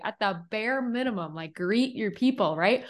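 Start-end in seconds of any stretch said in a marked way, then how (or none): none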